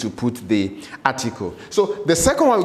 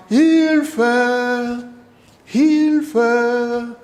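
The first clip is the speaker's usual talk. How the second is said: mock cry for help; 8 semitones higher than usual. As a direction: call Hilfe like someone in trouble. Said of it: "You shout".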